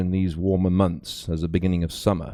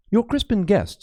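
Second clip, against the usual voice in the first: a lightly voiced young stranger, raised pitch; higher, eager young man's voice; stranger's voice; 12 semitones higher than usual. A narrator's reading. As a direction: call out his name as a young man from behind. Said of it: "said the male voice".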